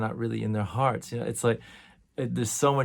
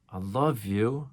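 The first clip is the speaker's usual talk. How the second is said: slow tender I love you; slower than usual. Shown as a demonstration. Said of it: "in twenty different ways".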